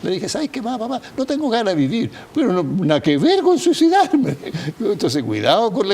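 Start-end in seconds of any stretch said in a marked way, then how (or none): 0.3-4.4 high-pitched imitation of a dismissive daughter, louder at the end; mock exasperated daughter. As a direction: play the daughter protesting, pitched way up. Hija responde, imitada por el psiquiatra. (hija indignada, imitada con voz aguda)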